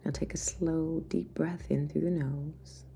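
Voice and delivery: soft spa whisper over music; guided meditation. Acted.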